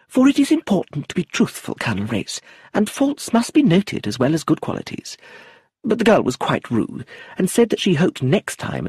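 high, prim chattering lady's voice; a high, fussy society widow rattling on; fussy old lady. Acted. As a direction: play her as a prim, talkative elderly aunt. Lucilla's long chattering speech.

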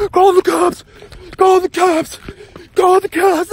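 high, loud mocking cry, repeated; mock panicked callers. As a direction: ham up a panicked bystander yelling.

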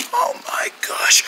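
slow, horrified whisper; lost voice. Pretend. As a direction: gasp it out with no voice left. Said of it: "I think I lost my voice".

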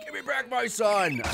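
mock action movie shout; action hero yell. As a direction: play the desperate action star on the phone. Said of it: "he's screaming that into the phone".

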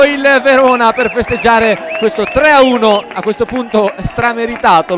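building to a loud, high goal shout; breathless italian goal commentary. Real, not acted. Commentator calls the third goal.

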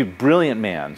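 ordinary delivery

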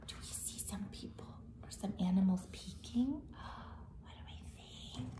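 fast hushed questions; whisper. Real, not acted. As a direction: whisper quickly, like sharing a secret. Teller whispers questions to children.

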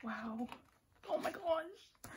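high, drawn-out awe; amazed. Real, not acted. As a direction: let it rise slowly in disbelief. Amazed by a picture.

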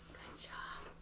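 whispered praise. Real, not acted.

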